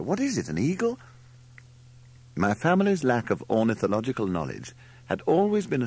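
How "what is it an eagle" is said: very high astonished question; exclaiming. A narrator's reading. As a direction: Margot exclaims, pitch leaping up. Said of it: "exclaimed Margot".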